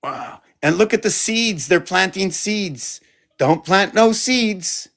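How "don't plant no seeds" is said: higher and slow, a put-on scold; scolding character voice. Voicing a figure in his painting who tells others off. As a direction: warn the little figures off.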